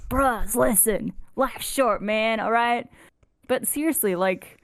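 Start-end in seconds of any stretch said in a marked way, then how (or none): 0.1-2.9 slow, laid-back stoner drawl; a chilled-out stoner, slow. Retold or imitated. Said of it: "I sound like a huge stoner".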